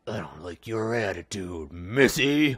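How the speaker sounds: low, slow, hammed-up stern father; mock stern dad. A dad scolds his teen daughter in a mock stern, low, slow voice.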